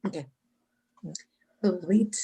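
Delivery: normal talking voice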